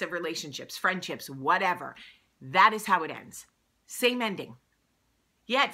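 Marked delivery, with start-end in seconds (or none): 2.4-3.5 THAT hit loud and falling to a firm end; stress on THAT. Real, not acted. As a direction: land hard on the word That, loud and final.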